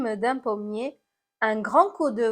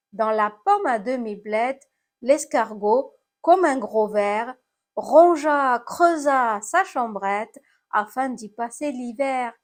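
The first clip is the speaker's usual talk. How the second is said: plain reading voice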